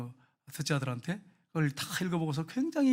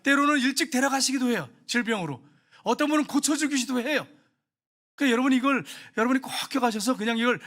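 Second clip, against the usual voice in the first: higher, louder sermon cry; full-force preaching; 7 semitones higher, 6 dB louder than usual. Real, not acted. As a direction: preach it out to the congregation.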